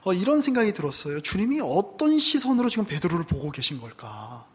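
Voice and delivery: ordinary talk, nothing marked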